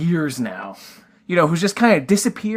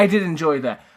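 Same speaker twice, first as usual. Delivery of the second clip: ordinary talk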